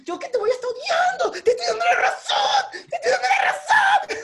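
mock wail shouted very high through laughter; grito exasperado. Exclamación exagerada al contar la discusión. (grito exasperado y exagerado, entre risas)

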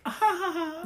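high, taunting laugh; mocking laugh. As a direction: laugh off the nukes. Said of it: "he'd be like".

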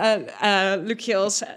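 ordinary talk

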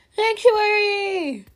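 high, loud, drawn-out wail of a word; dramatic cry. Acted. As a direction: call it out slowly like a theatrical plea. Acted cry after passing out.

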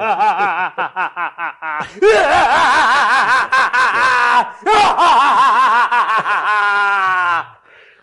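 loud booming evil cackle, building in levels; villain laugh. Shown as a demonstration. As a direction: throw back your head and cackle like a villain. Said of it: "Can you do us another version of the villain laugh?".

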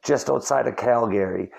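ordinary delivery

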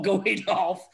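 ordinary talk

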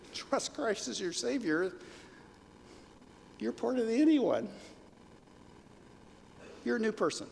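ordinary talk, nothing marked

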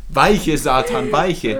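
langsam und feierlich, übertrieben; gespielte Beschwörung. Pretend. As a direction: wie ein Exorzist langsam beschwören. Mock exorcism. (spielt Teufelsaustreibung gegen die Mutter, langsam)

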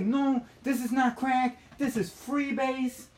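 high, slow mimicry of her protest; insistent denial. Woman denying it's crack, slow high-pitched insistence. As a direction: spell it out, high and drawn out.

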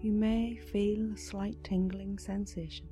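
soft, slow meditation voice; guided meditation. Acted. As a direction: guide them gently into calm.

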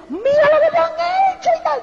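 imitating a shocked dealer; alarmed distributor. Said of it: "el distribuidor se pone y dice".

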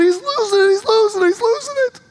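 frantic disciples, voice climbing and cracking; panicked cry. Retold or imitated.

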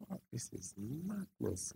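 slow, soft disbelieving mutter to himself; inner thought. Retold or imitated. New prisoner in Miami thinking to himself, a slow soft mutter. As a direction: murmur it like a private thought in a strange place. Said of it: "I'm thinking".

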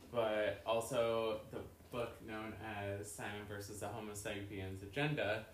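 ordinary talk

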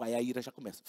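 normal talking voice

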